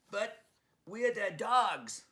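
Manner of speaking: a little Brooklyn dog pointing out the obvious; Brooklyn Chihuahua. Acted. Leon reminds Honey, high and drawn out. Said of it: "Leon reminded her".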